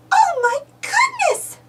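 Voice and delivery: very high startled outcry; aunt seeing the glitter mess, high surprised shout; shocked exclaim. A narrator's reading. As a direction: shriek it at the sparkly mess. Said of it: "exclaims Minnie".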